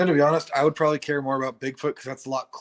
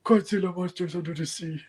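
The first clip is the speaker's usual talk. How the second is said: put-on childlike wonder; 6 semitones higher than usual. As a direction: marvel like a kid on Christmas morning. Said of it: "standard childlike wonder voice".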